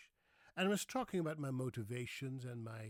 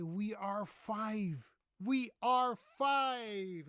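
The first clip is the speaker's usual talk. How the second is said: slow, emphatic, higher and louder; wife's insistent correction; 9 semitones higher, 9 dB louder, much slower than usual. Retold or imitated. Wife corrects her husband at the restaurant, slow and emphatic. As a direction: spell it out firmly, word by word. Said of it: "No, you just have to say".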